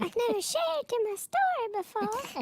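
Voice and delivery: squeaky mocking echo; mocking high voice. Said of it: "I'm glad you repeated it".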